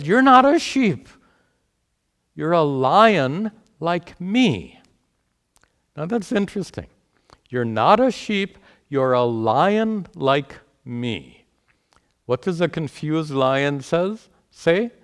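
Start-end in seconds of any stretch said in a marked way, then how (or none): 0.0-4.8 a lion's call, much higher and louder, slowing down at the end; proud lion. Acted. Passerby lion declares to confused lion. 7.5-11.5 repeating the lion. Retold or imitated. Teacher repeats the lion's line.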